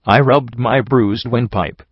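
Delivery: ordinary delivery, nothing marked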